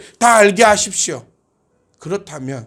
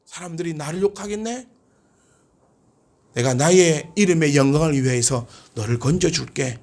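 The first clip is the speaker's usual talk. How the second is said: softened, lower divine reply; voice of God; 4 semitones lower, 5 dB quieter than usual. Acted. God promising rescue to the believer, quiet assurance. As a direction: speak as God deciding to rescue. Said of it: "이게 하나님의 지금 이 음성이시라는 겁니다".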